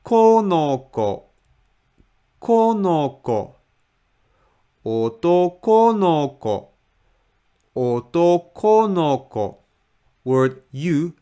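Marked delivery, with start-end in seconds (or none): 0.1-3.5 "konoko" slowly sliding down from a high first "ko"; falling tone. Shown as a demonstration. Teacher shows the fall after stress, "konoko" in falling tone. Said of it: "you read it with falling tone". 4.9-9.6 "otoko no ko" rising to the first "ko", then falling; stress on middle ko. Shown as a demonstration. Teacher demonstrates the whole phrase, "otoko no ko" rising then falling. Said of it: "had stress in the middle ko".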